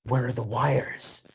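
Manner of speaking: lightly voiced Jackman, slow; slow, puzzled movie line, a touch of Jackman; a hint of Hugh Jackman asking where the wires are; quoting Jackman. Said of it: "and he's like".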